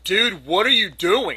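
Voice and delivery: loud, high, drawn-out disbelief; slow, incredulous outburst; exasperated scolding. Retold or imitated. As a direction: scold the dog in retold exasperation. Retold scolding of the dog.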